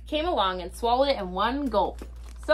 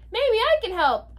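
put-on high, lightly raised boy's call; shout over wind; 11 semitones higher than usual. A narrator's reading. Boy offers help in the storm, high call. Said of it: "I shouted over the wind".

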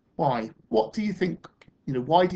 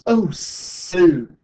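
loud, higher wondering exclamation; amazed admiration; 5 semitones higher, 8 dB louder than usual. Acted. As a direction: breathe in the marvel and exclaim it. Children amazed by the bird.

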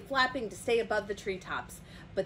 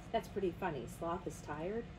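ordinary delivery, nothing marked

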